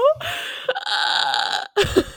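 squealing laugh; airy high-pitched giggle; high breathy laugh. Real, not acted. Reacting to six years.

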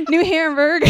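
laughing through the name; giggling while saying it. Real, not acted. Laughing retelling uncle's joke.

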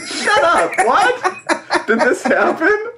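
high laughing astonishment; laughing out shocked questions; laughing disbelief. Real, not acted.

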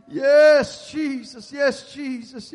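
loud, high pleading prayer; fervent prayer. Real, not acted. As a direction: cry out to God with urgency.

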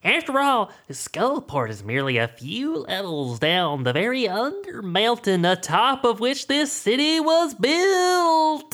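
slow, lofty lore-telling voice; pompous scholar explaining slowly; Volo voice. Acted. GM voicing Volo, lore dump.

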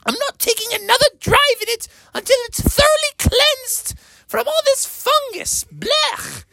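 high disgusted mother voice. Acted. Mother Bear complains about the fungus, high and disgusted. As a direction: squeal it up high, grossed out. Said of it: "And Mother was like".

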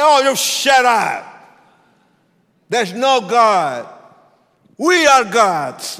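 high, loud, slow heckle; defiant shouting. Retold or imitated. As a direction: shout the preacher down. Gang leader shouting back at preacher.

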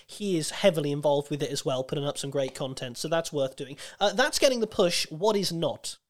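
normal talking voice

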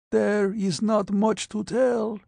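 high, frail voice with a touch of pain; pained frail patient. Acted. Patient answers the doctor about the fog, pained and frail. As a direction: push the words out through weakness. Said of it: "Losako said painfully".

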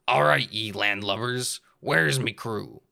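gruff pirate captain. Acted. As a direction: growl it like a pirate. Pirate character voice.

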